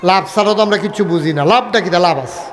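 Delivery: ordinary talk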